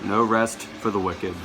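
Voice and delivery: ordinary talk, nothing marked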